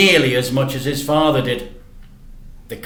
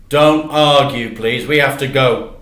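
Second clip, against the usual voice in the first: firm hurry; 7 dB louder than usual. Acted. Dad hurrying the boy.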